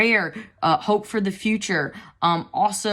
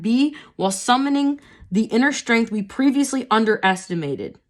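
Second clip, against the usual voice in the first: measured, slightly raised reading voice; reading aloud; 3 semitones higher, 3 dB louder than usual. Acted. Reading the card's guidebook text to viewers, measured reading voice. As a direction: read the guidebook passage evenly.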